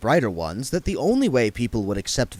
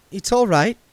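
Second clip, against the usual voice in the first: put-on higher, reassuring young woman; Saccharissa voiced; 6 semitones higher, 3 dB louder than usual. A narrator's reading. Saccharissa reassuring Otto, lightly voiced. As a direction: soothe the nervous vampire. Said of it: "said Saccharissa".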